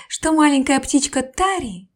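usual speaking voice

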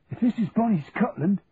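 a London cockney grumbling at the snow; Cockney recruit moaning about the Scottish cold; Cockney accent. Acted. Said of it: "Charlie heard a Cockney accent declare".

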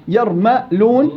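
"يرمى لون" slowly in parts, ma with an a vowel; mim with fatha. Shown as a demonstration. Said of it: "الميم تقبل الثلاث حركات". («يرمى لون» بفتح الميم مقطعة وببطء)

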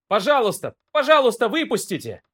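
high, frantic begging; desperate pleading. Acted. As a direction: plead to be let out in panic. Trapped man begging. (отчаянная мольба)

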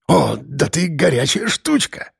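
breathed-out husky character voice; hoarse, besotted leer. A narrator's reading. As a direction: exhale it hoarsely, drunk on lust. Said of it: "выдохнул окончательно одурманенный парень, хрипло хохоча".

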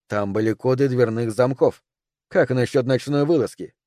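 ordinary delivery, nothing marked

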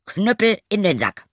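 hoher, lauter Befehl; Knüppel laut zurückrufen; Ruf. A narrator's reading. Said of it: "dann rief er". (lauter Zauberruf)

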